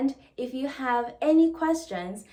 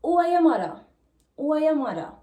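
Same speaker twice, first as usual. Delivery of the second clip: higher, louder Jamaican patois question; 6 semitones higher, 6 dB louder, much faster than usual. Shown as a demonstration. Teacher translates the mother question, in Jamaican patois. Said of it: "To ask this".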